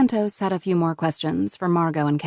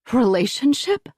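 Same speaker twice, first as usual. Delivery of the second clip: indignant echo, pitched up and louder; 6 semitones higher, 4 dB louder than usual. A narrator's reading. Mother echoing the agent's question, indignant. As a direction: throw the word back, offended. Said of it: "Margot repeated indignantly".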